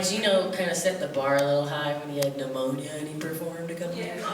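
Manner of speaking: ordinary talk, nothing marked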